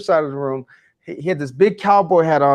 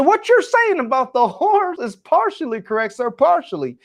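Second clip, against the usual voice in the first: imitating a cowboy, high-pitched East Texan accent; 11 semitones higher than usual. Cowboy at the back cutting off the speaker, East Texan accent. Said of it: "rich East Texan accent".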